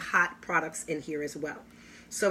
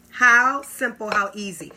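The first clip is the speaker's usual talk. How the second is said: excited; 2 semitones higher, 8 dB louder than usual. Real, not acted. Gushing over product demo.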